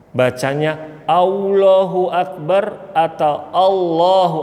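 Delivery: first "Allahu" rounded like "ulohu", then a clear "Allahu", slow; wrong then right Allahu. Shown as a demonstration. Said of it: "jangan pakai monyong".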